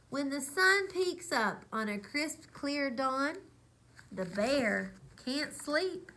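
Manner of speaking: lively storybook read-aloud; reading to children. A narrator's reading. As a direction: read it like bedtime story time. Reads picture book aloud.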